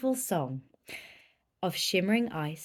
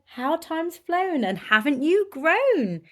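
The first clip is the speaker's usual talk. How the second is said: higher, fond marvel; fond greeting; 8 semitones higher, 5 dB louder than usual. Acted. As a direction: greet her fondly. Snails greet returning snail.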